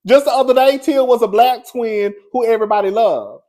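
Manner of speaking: excited. Real, not acted. Exclaiming about fans turning.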